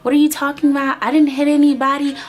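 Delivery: high, wide-eyed innocent voice; girl fooling the cops, high and innocent; innocent act. Retold or imitated. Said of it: "her whole voice, yo, she needs an Oscar".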